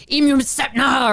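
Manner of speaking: high-pitched garbled spell cry; hammed-up movie villain summoning; mock incantation. Imitating him releasing them from a jar, mock incantation. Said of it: "and he's like".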